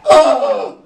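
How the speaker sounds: high squeal of surprise; a shrieked, delighted oh; surprised oh. Retold or imitated. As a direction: gasp it out, starstruck. Sudden recognition of a celebrity.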